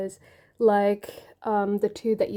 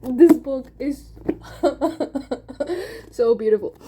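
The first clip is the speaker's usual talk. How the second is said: gushing through a laugh; 4 semitones higher, 4 dB louder than usual. Real, not acted. Praising the book to viewers through laughter. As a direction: laugh with giddy joy.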